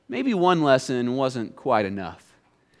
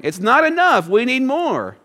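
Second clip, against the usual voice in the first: mock grumbling complaint; 9 semitones higher than usual. Voicing the grumbling people.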